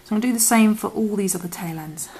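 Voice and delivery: ordinary delivery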